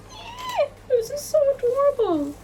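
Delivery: very high, squeaky cooing; adoring squeal. Real, not acted. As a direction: squeal over something adorable. Delight at little cat hair clips.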